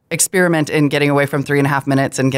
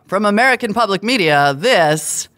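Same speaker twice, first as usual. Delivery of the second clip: radio host voice; 6 semitones higher than usual. Retold or imitated. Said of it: "He'll say".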